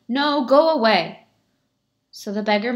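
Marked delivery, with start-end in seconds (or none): 0.1-1.3 loud, higher "go away"; shouted refusal. Acted. Villager shouting through the door at beggar, loud. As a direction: bark him off the doorstep.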